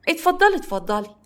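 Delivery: high, sugary insisting invitation; pushy welcome. Acted. Aunt pressing the fiancée to come inside, high and sugary. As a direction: gush at her to come in.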